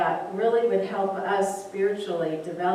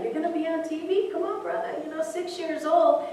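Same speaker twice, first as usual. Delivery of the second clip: encouraging inner voice; 9 semitones higher than usual. Retold or imitated. The soul telling the boy.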